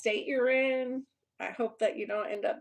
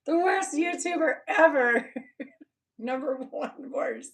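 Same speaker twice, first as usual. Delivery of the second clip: high voice breaking into laughs; mock announcement through laughter; laughing; 5 semitones higher, 4 dB louder than usual. Real, not acted. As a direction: crack up while declaring it. Laughing at mock survey answer.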